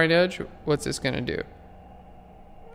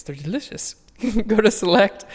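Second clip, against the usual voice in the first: laughing; 6 semitones higher, 4 dB louder than usual. Real, not acted. Laughing, back to the steps.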